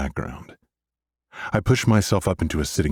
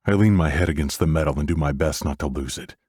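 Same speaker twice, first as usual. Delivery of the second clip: ordinary delivery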